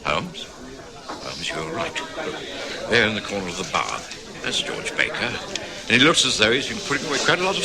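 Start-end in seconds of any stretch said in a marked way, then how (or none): 0.0-5.3 low, urgent near-whisper; hushed aside. Acted. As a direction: tip off Holmes without being heard. Pointing out man in pub.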